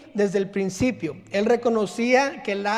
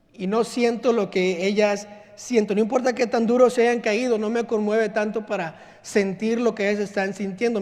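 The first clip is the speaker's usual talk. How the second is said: ordinary talk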